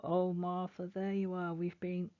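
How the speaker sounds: relieved mother. Acted. Mother relieved finding daughter.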